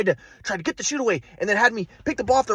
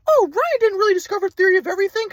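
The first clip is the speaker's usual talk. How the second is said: high, airy-calm imitated grandpa; spiritual grandpa; 12 semitones higher, 4 dB louder than usual. Grandpa from Kauai lecturing Ryan, high serene mock voice. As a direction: preach oneness like a serene guru.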